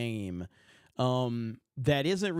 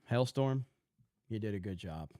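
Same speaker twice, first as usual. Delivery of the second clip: normal talking voice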